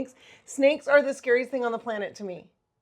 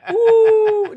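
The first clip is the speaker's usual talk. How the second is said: high laughing ooh; 11 semitones higher, 11 dB louder than usual. Real, not acted. Laughing ooh after ghost talk.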